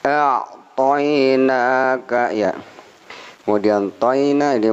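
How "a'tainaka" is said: "a'tainaka" read slowly with the long a; drawn out. Shown as a demonstration. Teacher models the right "a'tainaka". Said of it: "bukan a'taina tapi a'tainaka".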